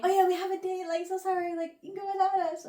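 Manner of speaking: high, sugary fake-sorry voice; mock sweet insincerity; fake apologetic. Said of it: "fake friends".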